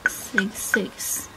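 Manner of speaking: normal talking voice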